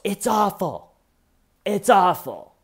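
high wail, falling away; mock lament. Suspect reacts to the video on the laptop, mock wailing. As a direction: imitate an overdramatic high-pitched moan.

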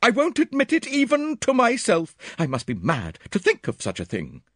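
high, loud refusal; agitated woman shutting down questions; distraught Miss Howard. A narrator's reading.

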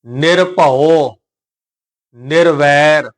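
ਨਿਰਭਉ, pause, ਨਿਰਵੈਰ, each very slow; separated pronunciation. Shown as a demonstration. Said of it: "ਇਹਨਾਂ ਨੂੰ ਅਲੱਗ-ਅਲੱਗ ਉਚਾਰਨ ਕਰਾਂਗੇ". (ਨਿਰਭਉ, ਨਿਰਵੈਰ ਅਲੱਗ-ਅਲੱਗ, ਲਮਕਾ ਕੇ)

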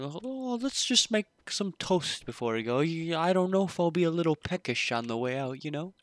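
hammed-up carefree kid; mock kid voice. Imitating the kid's thoughts.

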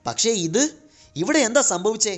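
high-pitched, astonished outburst; bewildered. A narrator's reading. Luke sees what happened to the giants, astonished. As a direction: ask it in amazement, pitch well up. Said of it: "Luke അമ്പരപ്പോടെ ചോദിച്ചു".

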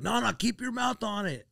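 high, strained urgent coaching; coaching stoner friend. Retold or imitated. Friends coaching a first bong hit, high urgent voice. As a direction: imitate a buddy urging him on.

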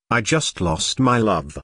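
plain reading voice

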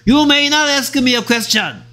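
high, slow, imperious decree; hammed-up dictator at a press conference; mock president. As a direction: lay down the rule to the press like a tyrant. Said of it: "대통령이 막 기자들 모아놓고".

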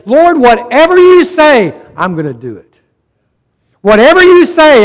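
loud, high, impassioned prayer; rising preacher's plea; fervent prayer. Acted. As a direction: cry it out to God with full conviction.

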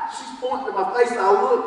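ordinary talk

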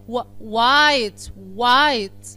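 "و" as a w glide, then "وايت" said loud as wajt. Shown as a demonstration. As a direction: build "وايت" from its w sound, loudly. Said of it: "بصوا انا نطقتها ازاي".

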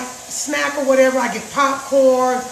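ordinary talk, nothing marked

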